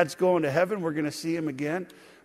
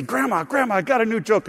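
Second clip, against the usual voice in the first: put-on higher, eager kid voice calling out; eager little boy; 6 semitones higher, 4 dB louder than usual. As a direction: pester grandma like a small boy. Kid pestering grandma with jokes.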